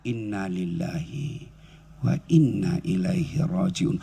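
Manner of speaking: slow, low and soft; slow, from the heart. Shown as a demonstration. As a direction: draw out each word while stroking the chest. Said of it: "Ngucapnya sambil usap dada".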